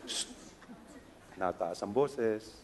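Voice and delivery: mild mock hush; hushed reminder. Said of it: "Sabi niya".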